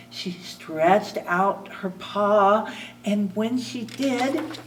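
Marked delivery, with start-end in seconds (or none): none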